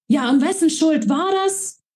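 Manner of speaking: high, cutting retort; angry retort. A narrator's reading. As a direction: hurl the question back at him. Ellie fires back in quarrel.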